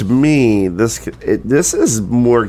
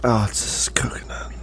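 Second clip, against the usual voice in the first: whispered, softer, almost to himself; hushed aside; 8 dB quieter than usual. Real, not acted. Friends tasting a coconut porter, whispering. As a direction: whisper it under your breath.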